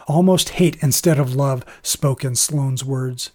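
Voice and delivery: usual speaking voice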